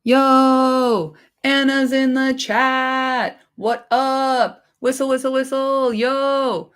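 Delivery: high, hyped call-out to a viewer; excited greeting. Real, not acted. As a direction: shout out the new arrival.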